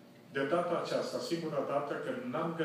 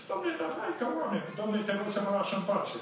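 exasperated retort; 7 semitones higher than usual. Retold or imitated. Irritated reply to man.

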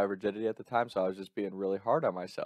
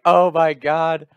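slow, loud, high wail of dismay; mock despair; 7 semitones higher, 11 dB louder, much slower than usual. Retelling his panic the next day, slow loud wail. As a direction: drag out the dismay, hammed-up. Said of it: "I just felt like".

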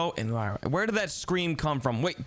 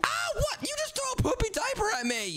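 shocked outrage. Acted. Hit by diaper.

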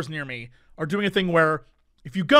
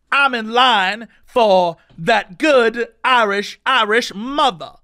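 slow, drawn-out imitation of a yelling drunk; mock drunken yelling; 3 semitones higher, 5 dB louder, much slower than usual. Drunk guy yelling to his friend in line, mock holler. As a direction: stretch each word like a drunk hollering. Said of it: "keep yelling at each other".

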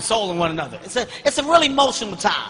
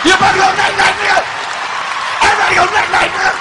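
raging uncle; 3 semitones higher than usual. Retold or imitated. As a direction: yell each threat like a madman.

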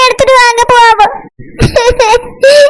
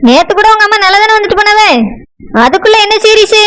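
lower-voiced, doubting wife; a wife who is unimpressed, lower voice; dismissive wife; 6 semitones lower than usual. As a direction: brush the news off, lower.

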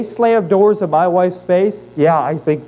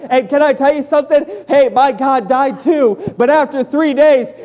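higher-pitched, driving pulpit delivery; full-force preaching; 8 semitones higher than usual. Acted. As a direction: preach it with mounting urgency to the congregation.

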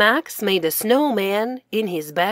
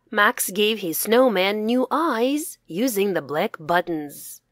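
plain reading voice

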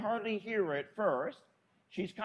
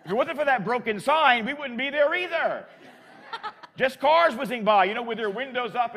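laughing; 6 semitones higher, 8 dB louder than usual. Real, not acted. Laughing disbelief at empty sidewalk.

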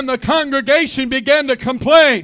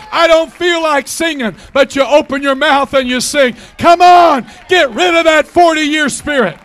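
full-force preaching; 2 semitones higher, 4 dB louder than usual. Real, not acted. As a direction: rouse the congregation with fire.

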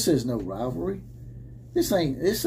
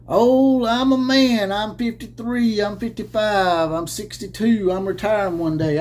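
higher and louder mock shout; mocking rant again; 5 semitones higher, 4 dB louder than usual. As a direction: parody the coach yelling. Repeating the rant impression.

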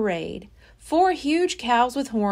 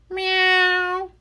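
cat meow; 8 semitones higher than usual. Acted. Cat meows again.

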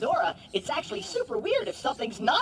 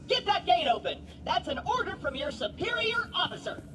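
ordinary talk, nothing marked

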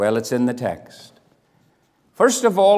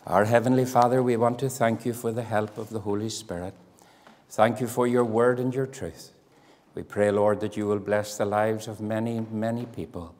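soft, lower pastoral prayer voice; closing prayer; 3 semitones lower, 4 dB quieter than usual. Real, not acted. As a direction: pray gently for the congregation.

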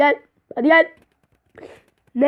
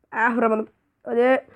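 ordinary delivery

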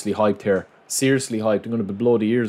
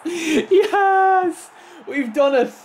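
high-pitched victory yell; celebrating scream; much slower than usual. Real, not acted. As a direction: scream it out in triumph. Celebrates a goal in game.

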